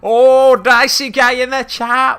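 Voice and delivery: very high, loud, drawn-out hype greeting; excited shout-out. Real, not acted. Streamer greeting Dicey Guy in chat with loud excitement. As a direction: belt out the welcome like a cheering host.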